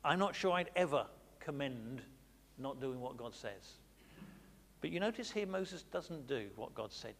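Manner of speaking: ordinary talk, nothing marked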